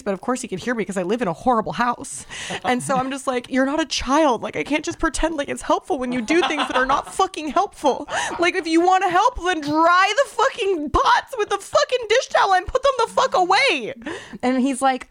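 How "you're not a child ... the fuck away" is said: re-enacted rant building to a high-pitched yell; mounting outrage at a partner; livid. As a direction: start exasperated, climb into shrill-pitched fury, hammering the swear words. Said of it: "And I'm so livid".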